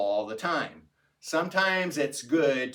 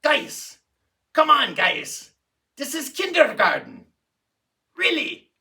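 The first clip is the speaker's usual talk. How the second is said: put-on raised, emphatic Russian-accented plea to the class; exasperated professor in a Russian accent, not mean; 13 semitones higher, 5 dB louder than usual. Professor pleads with confused class, Russian accent, exasperated. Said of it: "in his Russian accent".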